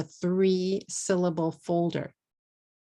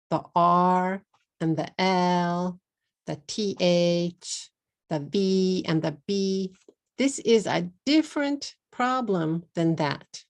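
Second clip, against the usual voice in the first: ordinary talk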